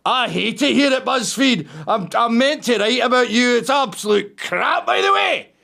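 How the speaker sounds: high, mock-camp confession; [name]. BuzzFeed writer griping to the host about the job, mock camp voice. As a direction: imitate a camp caller, pitch climbing at the end. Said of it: "what kind of accent I would have expected".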